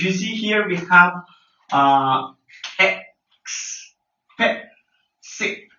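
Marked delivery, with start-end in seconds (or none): 2.8-5.7 "X, P, C" as three detached syllables of expensive. Shown as a demonstration. Teacher counts out three syllables for learners, syllable by syllable. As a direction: clear pauses after "X" and "P", ending on "C". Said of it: "three syllables".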